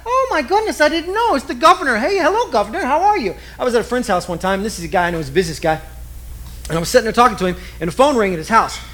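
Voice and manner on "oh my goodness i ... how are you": much higher, gushing over a big guest; gushing fan. Retold or imitated. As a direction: fawn over the governor in a high voice.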